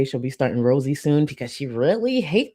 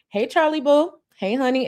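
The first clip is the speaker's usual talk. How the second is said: much higher, playful fan greeting; requested shout-out; 8 semitones higher than usual. Pretend. Streamer gives a viewer the requested hey boo, high and playful. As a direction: lift the whole greeting up high for them. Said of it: "can I get a hey boo".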